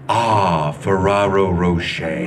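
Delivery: low, slow luxury ad voice on "Ferraro Rocher"; lightly voiced chocolate commercial; commercial voiceout parody. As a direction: purr it like a fancy TV ad. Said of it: "That's what the commercial says".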